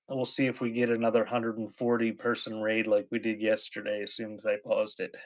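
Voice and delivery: usual speaking voice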